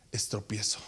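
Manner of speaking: whispered; preacher to the church, whispered. Acted. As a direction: drop to a whisper for the point. Whispered sermon point.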